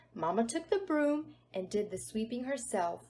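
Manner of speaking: usual speaking voice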